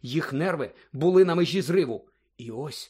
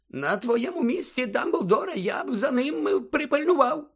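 very high-pitched, uneasy character voice; uneasy warning; 10 semitones higher than usual. A narrator's reading. Fudge warning Dumbledore about Snape, high and uneasy. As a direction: advise him in a high, worried voice.

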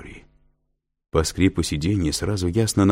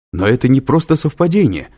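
raised, louder protest from a student; exclaiming; 7 semitones higher, 7 dB louder than usual. A narrator's reading. Student objecting to the professor's dismissal, exclaiming loudly. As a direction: cry it out as an indignant objection. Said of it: "вскричал юноша".

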